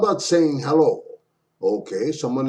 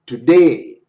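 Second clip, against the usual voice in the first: "today" with "day" louder and falling; stress on day; 9 dB louder than usual. Shown as a demonstration. Teacher showing English stress, "day" stronger in "today". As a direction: punch the second syllable of "today". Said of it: "day is stronger".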